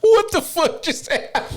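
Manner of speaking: high-pitched, laughing outburst; real laughing disbelief. Real, not acted. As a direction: squeal it through real laughter. Cracks up at friend's rant.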